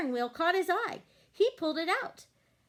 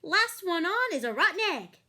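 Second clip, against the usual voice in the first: teasing chicken voice; 6 semitones higher, 6 dB louder than usual. A narrator's reading. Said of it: "what she thought was".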